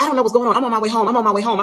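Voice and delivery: defensive husband imitation. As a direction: imitate him pleading ignorance. Husband denies it on phone.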